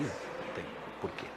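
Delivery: breathy whisper under the breath; whispered aside. Real, not acted. Pastor tells the church why the man left, in a whisper. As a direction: drop to a private whisper.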